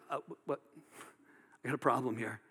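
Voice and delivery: quiet, halting disbelief; muted, stunned objection; taken aback. Acted. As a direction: stammer softly, pausing before a low-key objection. Acting out perturbed religious leaders.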